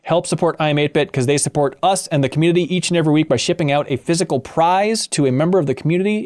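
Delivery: ordinary talk, nothing marked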